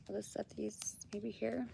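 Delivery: whispered aside while setting things down; soft unvoiced mutter. Real, not acted. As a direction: breathe it to yourself, barely voiced.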